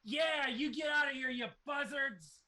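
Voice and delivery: high, loud cheering shout; Moorcroft's voice. Acted. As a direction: yell it up at the birds gleefully. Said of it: "Moorcroft is down there like".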